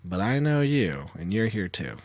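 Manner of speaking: low, soft and measured; hushed mock standoff; covert accusation. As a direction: quietly let them know they are caught too. Covert talk to bishop in theater.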